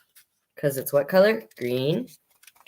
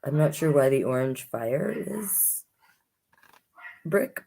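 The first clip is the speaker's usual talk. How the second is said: plain reading voice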